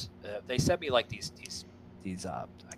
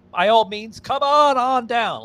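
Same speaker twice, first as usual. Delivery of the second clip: high, loud game show announcer; game show host; 10 semitones higher, 7 dB louder, slower than usual. Retold or imitated. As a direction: call it out like Bob Barker. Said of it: "The Price is Right".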